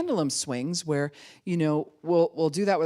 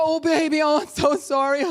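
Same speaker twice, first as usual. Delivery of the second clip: put-on high, louder gushing over the baby; overdramatic comforting; 10 semitones higher, 7 dB louder than usual. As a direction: ham up the fussing parent. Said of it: "You do this whole thing".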